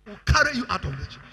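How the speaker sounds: firm, projected warning; forceful threat. Acted. Same threat, repeated with force.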